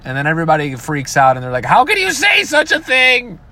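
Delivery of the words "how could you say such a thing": high, mock-scandalised gasp of a line; mock outrage. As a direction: play the pearl-clutching crowd in a high voice. Mocks outraged audience.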